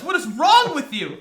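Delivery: incredulous loud outburst; outraged. Real, not acted. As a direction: demand it in disbelief. Outraged at backstabbing.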